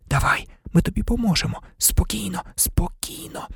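soft whispered reassurance; barely audible. A narrator's reading. Harry calming Buckbeak as he secretly helps, barely audible. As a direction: soothe in a hush, barely voiced. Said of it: "ледь чутно проказав Гаррі".

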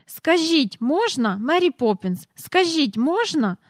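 high, insistent pleading; excited begging. A narrator's reading. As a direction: plead like a boy dancing with impatience. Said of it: "підхопив Майкл, аж танцюючи круг неї".

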